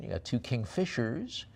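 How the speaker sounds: ordinary talk, nothing marked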